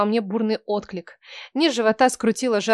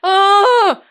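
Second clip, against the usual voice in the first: loud high scream; screaming in pain; 10 semitones higher, 8 dB louder than usual. Acted. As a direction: scream at the top of your lungs. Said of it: "орала я во всё горло". (крик во всё горло)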